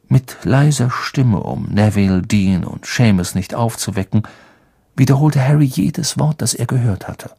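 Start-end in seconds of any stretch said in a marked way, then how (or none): none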